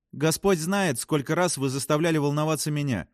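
high, testy retort; snapping back. A narrator's reading. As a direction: snap back at a crewmate. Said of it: "огрызнулась Фрэнсис". (огрызаясь, высоким голосом)

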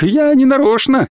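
high-pitched panicked apology; thin wail. A narrator's reading. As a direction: whine your innocence in a thin voice. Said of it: "тоненько провыл он".

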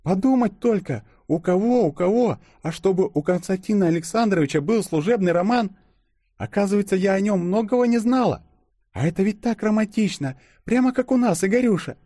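high, gossipy female voice; gushing girlfriend. A narrator's reading. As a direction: gush about a romance in a high voice. (восторженная подруга, высоким голосом)

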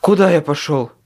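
hoarse, winded question; out of breath. A narrator's reading. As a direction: gasp it out breathlessly. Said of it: "Задыхаясь, прохрипел я".

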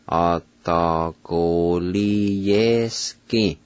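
"Atagoliyeski" stretched out, syllables joined together; slow pronunciation. Shown as a demonstration. Teacher pronounces Cherokee word for test, "Atagoliyeski" slow and connected. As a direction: draw out each syllable of "Atagoliyeski" without pausing. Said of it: "now I'll pronounce them".

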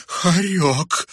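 high, loud cry of dread; panicked outburst; alarmed exclamation. A narrator's reading. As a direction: cry out, forgetting caution. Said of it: "воскликнула Хомели".

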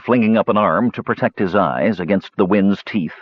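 ordinary delivery

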